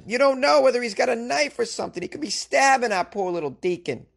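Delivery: high-pitched, alarmed Boston parishioner; Boston accent. Acted. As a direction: fret in a broad, put-on Boston accent. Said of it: "I slipped into this Boston accent".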